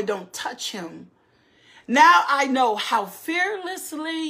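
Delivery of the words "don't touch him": hushed command. Acted. Angel stops Abraham.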